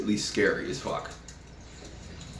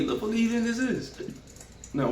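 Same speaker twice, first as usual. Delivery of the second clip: normal talking voice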